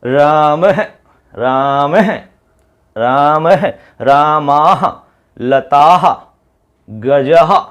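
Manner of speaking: "रामाह" said slowly, with a breathy "ह" from the throat at the end; demonstrating the visarga. Shown as a demonstration. Teacher showing the class how visarga sounds. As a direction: stretch "रामाह, लताह, गजह" out with a breathy final "ह". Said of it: "अब आप बोल के देख सकते हैं".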